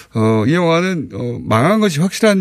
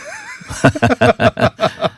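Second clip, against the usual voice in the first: big burst of laughter; hearty laugh. Real, not acted. Laughs at host's joke.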